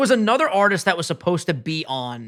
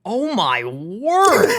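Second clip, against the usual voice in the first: amazed; 4 semitones higher, 3 dB louder, much slower than usual. Real, not acted. Amazed at numbers.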